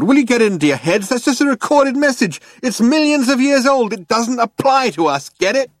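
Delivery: high, loud, fast rant; exasperated. A narrator's reading. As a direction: hammer it into them at a frantic high pitch. Zaphod exasperated at worried crew.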